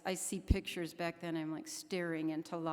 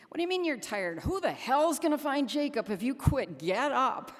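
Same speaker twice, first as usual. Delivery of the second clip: loud, high bellow pushing her to get up; tough-love shouting from a friend; retold yell; 7 semitones higher, 7 dB louder than usual. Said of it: "And he would yell at me".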